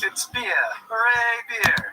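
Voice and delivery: put-on high ad-man Jamaican accent, lightly done; light Jamaican commercial voice; imitating Jamaican ad voice. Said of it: "Remember that one guy who said".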